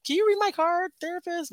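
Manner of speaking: high, teasing ask; a cheeky little-girl plea; playful mock request. Asking the spiritual therapist for a reading, high and teasing.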